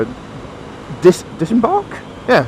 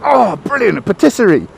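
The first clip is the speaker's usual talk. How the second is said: higher, delighted exclamation; light up at a lucky find; acted delight; 5 semitones higher than usual. Hungry man spots a patisserie.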